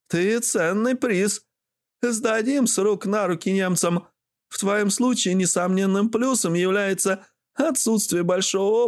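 высокий голос бойкой старухи; поучай по-свойски, высоким бабкиным голосом; ворчливая бабуля. A narrator's reading. (ворчливая бабушка наставляет внука высоким голосом)

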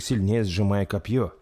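usual speaking voice

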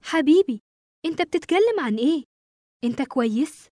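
puzzled surprise. A narrator's reading. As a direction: question him in mild disbelief. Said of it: "رفعت حواجبها بدهشة وهي بتقول".

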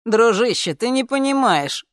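urgent appeal to a buddy; insistent plea. A narrator's reading. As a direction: plead with a friend who won't get it. Friend pleading against refusal.

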